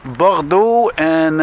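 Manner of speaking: "Bordeaux" slow, with a French r; "Bordeaux" spoken slowly in French; drawn-out French name. Real, not acted. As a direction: stretch out "Bordeaux" reading the sign. Reading street sign slowly.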